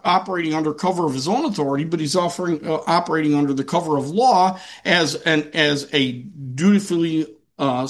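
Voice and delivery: usual speaking voice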